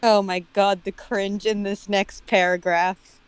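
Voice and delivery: ordinary delivery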